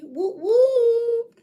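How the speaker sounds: high loud whoop; excited cheer. Real, not acted. Celebratory call.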